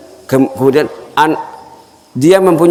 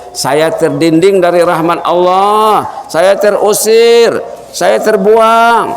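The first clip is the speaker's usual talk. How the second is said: anguished lament; 6 semitones higher, much slower than usual. Acted. The host tells his friend why he weeps, anguished and slow. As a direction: cry it out as if cast away from God's mercy.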